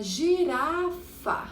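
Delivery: "girafa" slowly drawn out; long, sing-out "girafa" for counting; stretched-out syllables. Shown as a demonstration. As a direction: linger on each syllable of "girafa". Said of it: "Três sílabas".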